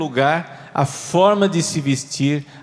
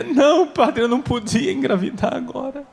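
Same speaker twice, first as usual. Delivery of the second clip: high, half-laughing protest; mock young wife, high and laughing; high woman's voice; 7 semitones higher, faster than usual. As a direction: plead as the wife, high and amused.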